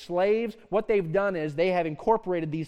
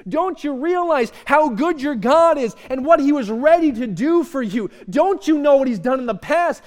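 earnest preacher pleading loudly as the angel; 8 semitones higher, 11 dB louder than usual. Acted. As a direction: beg them to see what they threw away. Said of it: "He's pleading with them".

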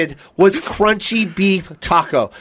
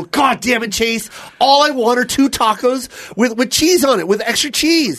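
high, exasperated retold rant; mad; 4 semitones higher than usual. Retelling his tirade at his friend in the car, mad. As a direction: complain hotly, pitched up. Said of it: "And I'm mad".

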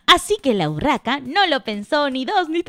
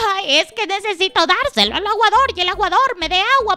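exhausted magpie; 9 semitones higher, 5 dB louder than usual. Acted. Weary magpie begging the hen for an egg, high pleading. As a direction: beg in a high bird voice, rattling through the list. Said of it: "agotada, cansada, vio a la gallina negra y le dijo".

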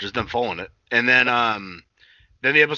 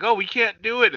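high, louder whimper of the dancers; mock the helpless villains; whiny characters; 10 semitones higher, 4 dB louder than usual. To his cohost, voicing the villains who can't stop dancing, in a high whiny mock voice. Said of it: "and they're like".

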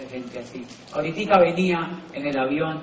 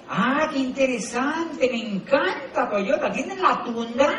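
higher, slow, hammed-up fascination; mock delight; 8 semitones higher, slower than usual. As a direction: lay the interest on thick, slowly. Feigning delight with the stranger. (fascinación exagerada y lenta)

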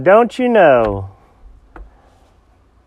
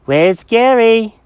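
high, slow cat voice asking; high-pitched, drawn-out character question; cat Jenkins asking; 12 semitones higher, 5 dB louder, much slower than usual. Acted. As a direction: ask it simply in a high cat voice. Cat asks kids, first take.

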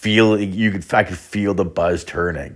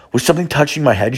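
alarmed; 7 semitones higher, faster than usual. Retold or imitated. Retold alarm on waking up.

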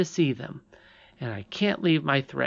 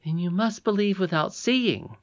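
insistent; 7 semitones higher than usual. Acted. Princess argues with doubting Curdie.

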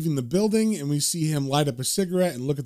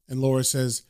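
ordinary delivery

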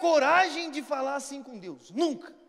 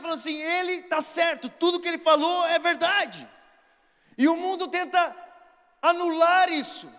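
louder, soaring preacher's proclamation; preaching at full force; 2 semitones higher, 7 dB louder than usual. Acted. Preacher urging the world to know Jesus, fervent shout. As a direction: preach it out to the whole congregation.

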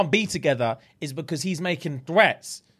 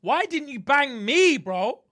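mock-hurt protest; 8 semitones higher, slower than usual. Acting out the jilted man's protest.

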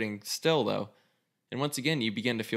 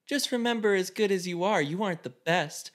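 higher, slowing on the last words, a hint of condescension; lightly voiced superior rival; smug warning; 7 semitones higher than usual. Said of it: "We'll see".

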